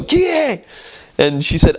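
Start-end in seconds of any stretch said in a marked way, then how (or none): 0.0-0.6 very high, sing-song Italian call of "Kie?"; Italian door call. Retold or imitated. Italian householder calls through the door at knocking missionaries, high and sing-song. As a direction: imitate how locals always call through the door. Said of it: "the way they ask".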